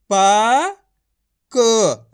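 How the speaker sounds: प and क each loud, held and separate; letter by letter. Shown as a demonstration. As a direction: sound out प, then क, with a clear gap. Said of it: "एक अक्षर का उच्चारण करके".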